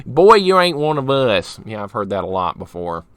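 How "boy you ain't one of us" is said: imitating Southern locals; mock a thick Southern accent. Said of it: "People tell me that live down here that talk like that".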